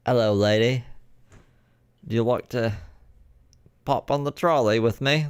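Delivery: hammed-up British pickup line; British chat-up. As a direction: flirt in a put-on British accent. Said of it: "what a British Riz would sound like".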